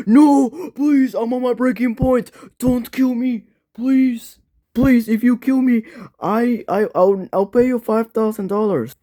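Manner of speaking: high, frantic pleading; panicked high plea to spare him; begging for life. Acted. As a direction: beg not to be killed.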